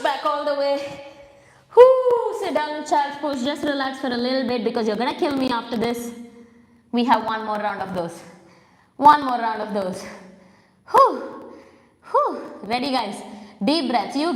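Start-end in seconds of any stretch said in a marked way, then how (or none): 1.8-2.4 high loud "whoo" of relief; relieved whoop. Real, not acted. Winded coach after push-ups. 10.9-12.4 sharp high breathy "whew" after effort; exertion exhale. Real, not acted. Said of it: "I'm breathing hard".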